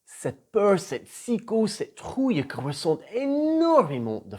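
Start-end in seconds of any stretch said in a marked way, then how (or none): none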